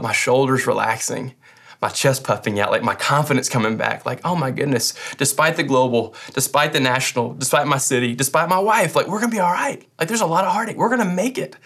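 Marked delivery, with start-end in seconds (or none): 4.2-11.6 rising higher, bursting with relief; joyful relief. Retold or imitated. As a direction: let the hope climb up into joy. Retold joyful relief in worship.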